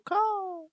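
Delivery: high crying caw; a harsh bird cry; raven caw. Acted. Raven caws mid-warning, high and sharp.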